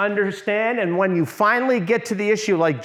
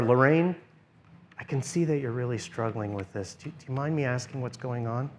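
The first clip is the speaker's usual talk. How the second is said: soft, lower, calming question to someone uneasy; 6 semitones lower, 7 dB quieter than usual. Shown as a demonstration. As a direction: speak quietly so she feels safe. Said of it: "Lower your voice, quiet, turn down the volume".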